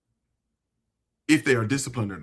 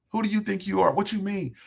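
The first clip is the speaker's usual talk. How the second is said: incredulous friends; 7 semitones higher than usual. Retold or imitated. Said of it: "You should see people's faces".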